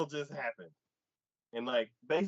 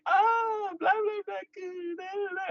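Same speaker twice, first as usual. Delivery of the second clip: very high, squeaky blah blah; hammed-up fawning woman; mock fawning woman. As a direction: squeal it like a gushing girl.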